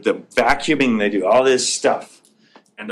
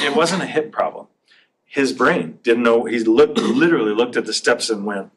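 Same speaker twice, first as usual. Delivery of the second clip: normal talking voice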